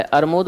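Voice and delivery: अरमूद as "ar-mod", r ahead of m; child's mispronunciation. Retold or imitated. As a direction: say अरमूद with r and m swapped. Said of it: "इसलिए वो क्या बोल रहा है".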